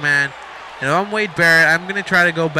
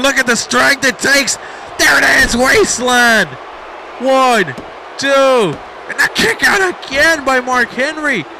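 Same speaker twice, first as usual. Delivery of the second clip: shouted climax call; 7 semitones higher than usual. Real, not acted. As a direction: belt out the slam and the count. Commentator calls slam and count.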